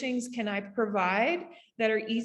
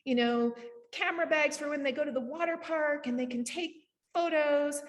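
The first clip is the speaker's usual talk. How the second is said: higher, lightly mocking voice of her eager younger self; imitating her past self; 5 semitones higher than usual. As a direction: replay your old daydream, voice lifted and a touch dreamy. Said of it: "I thought I had all these visions".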